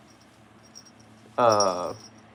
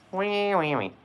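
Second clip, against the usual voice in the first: higher squeaky wees; 7 semitones higher, slower than usual. Acted. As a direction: squeak them out playfully. Playful squeaky noises on stream.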